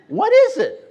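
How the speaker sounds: very high, loud asking; excited question. Retold or imitated. Young man pressing the leader, very high. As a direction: squeak it out in amazement. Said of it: "asking the leader".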